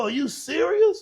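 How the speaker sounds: high, slow, stunned "Are you serious?"; high incredulous friend, slowed down; amazed disbelief. Retold or imitated. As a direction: marvel at the big house, drawing it out. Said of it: "he go".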